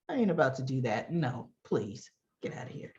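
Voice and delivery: low, fast brush-off fading into a whisper; dismissive refusal. Retold or imitated. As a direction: wave them off, dropping to a hush. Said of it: "She's like".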